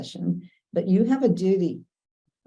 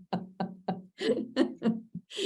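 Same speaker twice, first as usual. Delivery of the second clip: a long, high, breathy laugh at the memory; real laugh; 6 semitones higher, 5 dB quieter than usual. Real, not acted. Lawyer laughing as she recalls her mother's remark.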